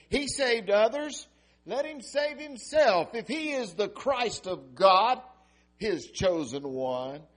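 high, loud scornful jeer; mocking rulers. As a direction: sneer it like a mocking crowd. Rulers scoffing at Jesus.

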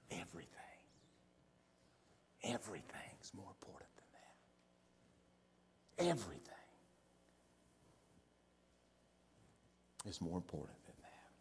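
very low and soft, near whisper; hushed answer. Real, not acted. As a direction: let each phrase drop almost to nothing.

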